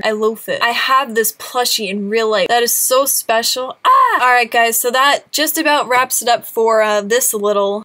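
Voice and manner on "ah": high, louder happy squeal. Real, not acted. Vlogger squealing over a displayed plushie. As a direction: let out a joyful squeal.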